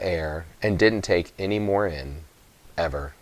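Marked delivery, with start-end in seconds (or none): none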